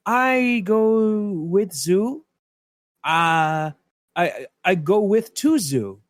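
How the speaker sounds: put-on slow, halting English in a feigned foreign accent; drawn-out foreign accent, pretending not to understand. [name] plays at not understanding English, in an accent. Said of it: "Using her lovely a-, accent and pretending".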